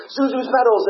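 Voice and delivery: high, loud, elated movie-hero cry; Jimmy Stewart. Retold or imitated. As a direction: imitate an overjoyed man exclaiming at the end. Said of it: "Jimmy Stewart in A Wonderful".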